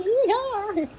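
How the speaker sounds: high mock-Southern whoop; hammed-up Southern holler; Southern US accent. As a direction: toss out a high cowboy "Yeehaw" in a Southern accent.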